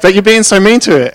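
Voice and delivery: high-pitched mock pleading; imitated wife's protest. Imitating wife's objection in argument.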